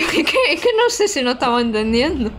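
talking through a laugh. Real, not acted. Streamer amused at muddled chat with foreign viewer, laughing. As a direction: break into laughter while speaking.

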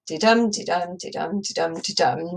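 didum repeated evenly, beat on dum; regular iambic beat. Shown as a demonstration. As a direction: tick out the rhythm like a clock. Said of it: "Nice, regular iambic rhythm".

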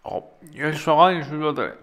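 talking through a mouthful of food; words muffled by a bite of food; mouth full. Real, not acted. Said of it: "don't talk with your mouth full".

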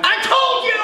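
high, loud yelp at God; hammed-up I told you; exasperated shout. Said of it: "And I went".

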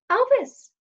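sudden high cry of the dog's name. A narrator's reading. Girl calling for her missing dog, sudden high cry. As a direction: shout it high and sharp. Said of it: "Daphne cried suddenly".

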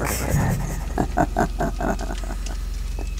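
evil laugh. Acted. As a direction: cackle low in triumph. Villain mocks his victim.

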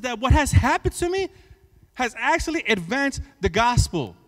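loud building sermon voice; rising fervent preacher; preaching at full force. Acted. Preaching climax.

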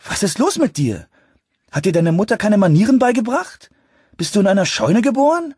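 high, loud, indignant scolding; annoyed and confused. A narrator's reading. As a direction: scold in rising exasperation. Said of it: "sagte er verärgert und verwirrt". (verärgert und verwirrt, laut schimpfend)

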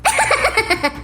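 short quiet mocking laugh; scornful laugh. Daughter laughing at her father's warning, scornful laugh. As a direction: laugh him off with contempt.

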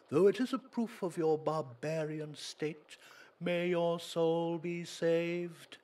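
pompous priest. Acted. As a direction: look down on the barbarian. Priest scorning the magician.

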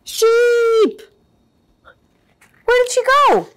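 high loud shout, slowing in worry; frantic calling. Acted. Sheepdog searching for Sheep, loud worried shout. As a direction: yell across the farm in alarm.

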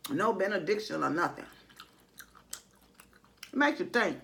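chewing while talking; speaking between bites; mouth full. Real, not acted. Seen 0: forkfuls to mouth, puffed chewing cheeks seen while speaking.

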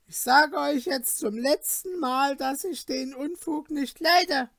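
innkeeper's wife angrily refusing to change her house rules; angry landlady. Acted. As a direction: scold the cheeky guests sharply. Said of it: "rollte zornig die Augen".

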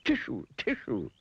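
slow, high pretend sneezes; sneezing pig. A narrator's reading. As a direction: sneeze out each word. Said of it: "sneezed the pig".

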